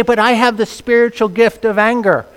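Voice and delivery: mock complaint. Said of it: "You say".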